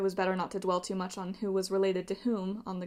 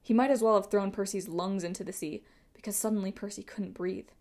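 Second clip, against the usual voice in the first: ordinary delivery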